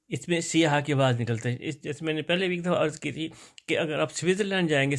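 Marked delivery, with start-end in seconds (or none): none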